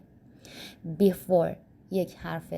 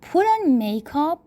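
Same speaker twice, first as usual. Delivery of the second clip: slow model phrase; 7 semitones higher, 4 dB louder, much slower than usual. Shown as a demonstration. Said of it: "فعل هفتم".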